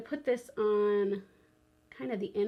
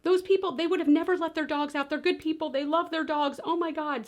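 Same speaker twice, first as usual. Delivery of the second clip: mock panic; 6 semitones higher than usual. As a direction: play the worried neighbor, rising alarm. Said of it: "this poor woman starts kind of panicking".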